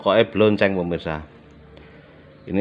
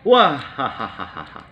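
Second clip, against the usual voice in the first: laughing; much slower than usual. Real, not acted. Laughing at food.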